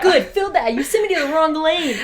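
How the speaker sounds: loud, high pretend telling-off; playful outraged outburst; mock scolding. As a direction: indignantly scold on a friend's behalf. Co-host acts out scolding the attendant.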